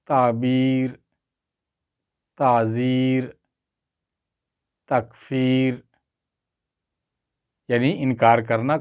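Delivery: each word read out slowly, with long pauses; measured vocabulary drill; slow word list reading. Shown as a demonstration.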